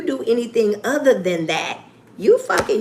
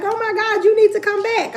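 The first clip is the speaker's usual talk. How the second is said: much higher, eager mock fan voice; excited fan; 13 semitones higher, 3 dB louder than usual. Imitating a fan urging her to return, much higher pitched. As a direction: gush like a thrilled fan. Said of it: "she was like".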